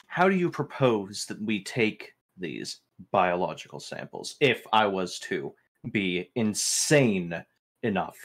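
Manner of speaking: ordinary talk, nothing marked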